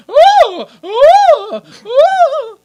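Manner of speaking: high, loud fake moans; mock moaning "ooh". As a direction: hoot out exaggerated sex moans. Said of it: "seconds of her just going".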